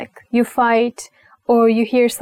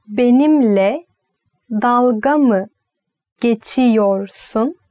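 slowed teaching; slower than usual. Shown as a demonstration. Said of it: "slow teaching split".

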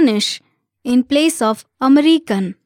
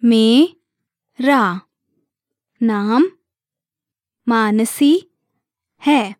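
broken down by syllable. Shown as a demonstration. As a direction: say it piece by piece, leaving a gap after each. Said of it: "Let's break it down by syllable".

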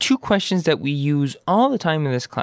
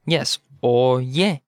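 ordinary talk, nothing marked

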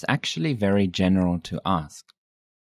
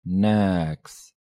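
"Niks" drawn out slowly; slow demonstration. Shown as a demonstration. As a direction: hold the vowel of "Niks" long. Teacher repeats word slowly.

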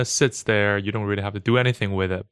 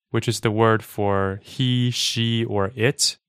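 usual speaking voice

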